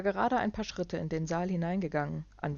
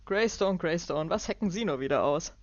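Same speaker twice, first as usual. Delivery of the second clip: put-on wag a finger at an old rival; jovial businessman; 3 semitones higher, 6 dB louder than usual. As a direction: Clark teasing Alvarez, loud and hearty. Clark's voice.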